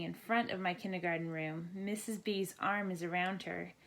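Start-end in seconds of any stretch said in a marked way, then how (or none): none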